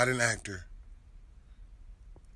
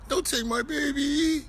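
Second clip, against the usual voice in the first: much higher, squeaky crybaby plea; exaggerated mock whine; 10 semitones higher than usual. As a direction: push the mocking whine way up high. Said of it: "You sound like a bitch".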